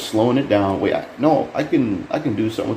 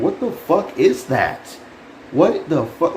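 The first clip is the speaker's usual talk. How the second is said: high, baffled what-the-fuck; pitched-up stunned exclamation; incredulous disbelief; 10 semitones higher, slower than usual. Real, not acted. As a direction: sound genuinely thrown by what you see. Reacting to bizarre trailer clip.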